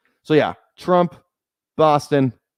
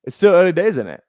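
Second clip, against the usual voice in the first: louder, higher mock British-Australian accent; botched British drifting into Australian; UK accent slipping Australian; 7 semitones higher, 7 dB louder than usual. Streamer attempting Love Island slang, UK accent turning Australian. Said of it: "That's more Australian".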